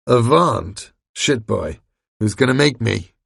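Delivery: loud, incredulous monster scoff. A narrator's reading. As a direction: scoff like a thug.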